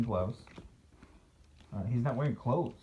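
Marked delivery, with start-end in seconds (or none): none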